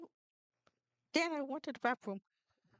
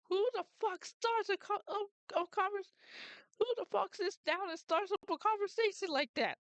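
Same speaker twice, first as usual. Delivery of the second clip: a lightly done high whiny cartoon dad; Peter Griffin; 7 semitones higher than usual. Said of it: "then Peter's like".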